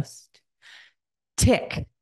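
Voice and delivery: hushed isolated "St-", a pause, then "tick"; sound deletion demo. Shown as a demonstration. Students answer a deletion drill, "St-" separated from "tick". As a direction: split off "St-", then give "tick" alone. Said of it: "And the students would go".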